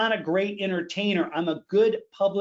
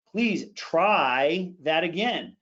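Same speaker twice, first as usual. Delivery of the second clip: "try" stretched out and emphasized; slow down and lean on "try"; stress on try; much slower than usual. Shown as a demonstration. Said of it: "Emphasis and intensity are absolutely critical".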